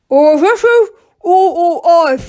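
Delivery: high, loud stammering bandit through a knife; spluttering around a knife in his mouth; impatient bandit. A narrator's reading. Bandit chief stammering at the gnomes, loud and high. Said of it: "said the head bandit impatiently".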